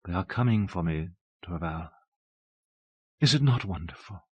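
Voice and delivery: softly, as if lingering in a blissful vision. A narrator's reading. Abbess telling Torval of those coming for her, soft. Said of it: "in the same soft voice".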